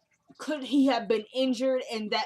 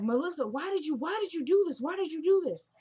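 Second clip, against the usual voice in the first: higher, pleading retold panic; panicked pleading; 7 semitones higher than usual. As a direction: beg her to explain, voice up high. Son pleading with bleeding girlfriend.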